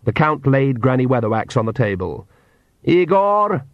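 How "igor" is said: high acted call; narrated shout, high but not full force; shouted summons. A narrator's reading. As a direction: call sharply for a servant, pitch raised. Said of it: "he shouted".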